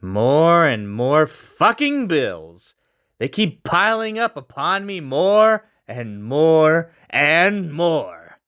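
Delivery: slow, bitter grumbling over the bills; bitter frustration. Acted. Loris alone with his bills, slow bitter grumble. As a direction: drag it out like a man fed up. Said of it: "Loris was bitter with a frown".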